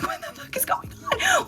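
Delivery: squeaky high, slow, stunned cry; shocked disbelief. Retold or imitated. Stunned when the guard says her charge is worse, squeaking up high. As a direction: let the voice shoot way up in total disbelief. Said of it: "I'm like".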